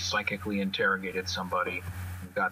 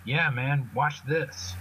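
Spitter showing off; 5 semitones higher, much slower than usual. Acted. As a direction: brag it to the crowd. Spitter shows off his power.

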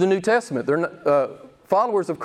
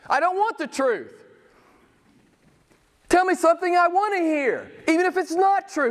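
a light, higher mock voice of a foolish listener; 10 semitones higher than usual. Preacher mimicking people who reject truth, mildly mocking. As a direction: lightly play the listener who wants comfortable lies.

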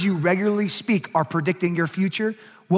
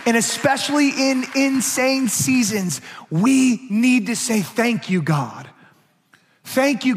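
higher, louder preaching push; 6 semitones higher, 3 dB louder than usual. Acted. Pastor pressing his congregation, full preaching voice. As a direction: preach it hard to the room.

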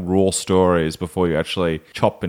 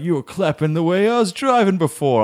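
angry driver accusation; 9 semitones higher than usual. Retold or imitated.

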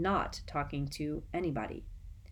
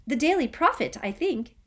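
high, louder eager answer; eager young boy; 9 semitones higher, 6 dB louder than usual. Acted.

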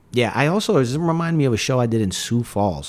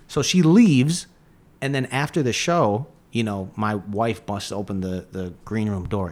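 ordinary talk

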